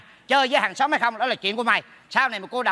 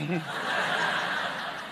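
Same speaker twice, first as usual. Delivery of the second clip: soft, voiceless snickering; mocking laugh; 9 semitones lower, 10 dB quieter than usual. Neighbour teasing him about bathing outside, quiet mocking laugh.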